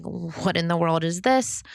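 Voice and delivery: ordinary delivery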